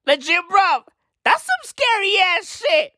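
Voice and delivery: high-pitched comic alarm; spooked reaction. Acted. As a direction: react as if creeped out, pitch jumping up.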